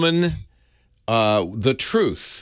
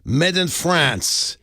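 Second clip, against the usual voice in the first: put-on French accent; 2 semitones higher, much slower than usual. Reading French label aloud.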